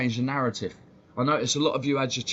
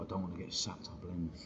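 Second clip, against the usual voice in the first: mock inner thought; 6 semitones lower, 13 dB quieter than usual.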